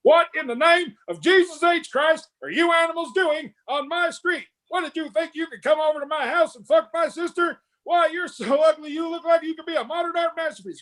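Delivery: high-pitched, full-force drill sergeant tirade; high sustained parade-ground yell; Full Metal Jacket drill sergeant. Retold or imitated. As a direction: scream insults like a drill instructor chewing out recruits. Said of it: "the drill sergeant from Full Metal Jacket".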